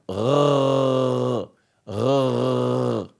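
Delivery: soft throaty gargle uh; gargling sound. Shown as a demonstration. Teacher imitating gargling water. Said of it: "you go like".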